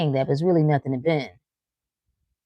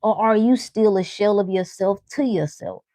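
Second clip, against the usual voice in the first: ordinary delivery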